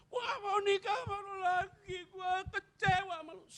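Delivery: high, tearful mock wife's wail; wife crying out. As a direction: imitate the wife's hurt, crying outburst. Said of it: "dia waktu marah kayak gitu".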